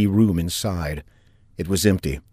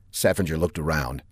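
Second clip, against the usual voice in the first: ordinary delivery, nothing marked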